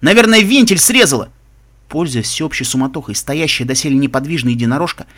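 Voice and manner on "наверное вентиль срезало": high, loud, agitated retort; heated self-defence at raised pitch; indignant bark. A narrator's reading. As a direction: shout the excuse back, indignant. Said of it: "возмущённо рявкнул второй".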